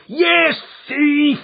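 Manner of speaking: high, loud roared insult. Acted. Father shaking his son, roaring at him. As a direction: roar it into his face. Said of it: "I roared".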